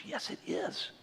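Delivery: breathy whispered yes it is; wife whispering back at husband; whispered insistence. Retold or imitated.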